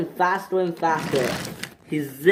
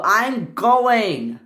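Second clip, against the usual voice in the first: higher-pitched protest, mildly raised; shouted reply; 6 semitones higher than usual. Acted. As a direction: call back with a mild shout. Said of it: "shouted Hugo".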